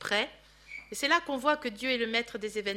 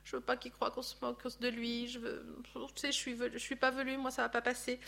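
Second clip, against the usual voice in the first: hushed worried reasoning; 9 dB quieter than usual. Retold or imitated. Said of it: "il n'avait que des arguments pratiques".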